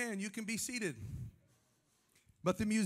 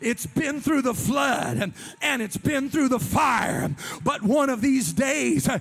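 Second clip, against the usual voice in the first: preaching at full force; 3 semitones higher, 7 dB louder than usual. Acted. Pastor calling the church to stand and praise, shouting. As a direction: whoop it up till they stand. Climax of the sermon.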